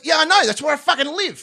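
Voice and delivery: high, loud acted exasperation; exasperated protest. Driver answers the prosecution about California, exasperated. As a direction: protest as if it's blindingly obvious.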